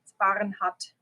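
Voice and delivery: Bahnhut with no r and a short hut; German pronunciation. Shown as a demonstration. Correcting viewers on her name, Bahnhut the German way. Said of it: "How do you guys pronounce that here?".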